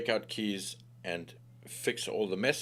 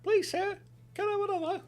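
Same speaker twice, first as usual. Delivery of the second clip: put-on very high, begging child act; hammed-up, high-pitched plea; pleading orphan voice. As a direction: squeak it like a hungry orphan begging. Playful begging urchin act.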